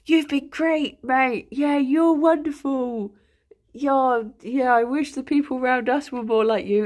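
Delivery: overly cheerful imitation of fans; gushing fan praise. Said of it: "being like".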